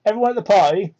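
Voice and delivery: ordinary talk, nothing marked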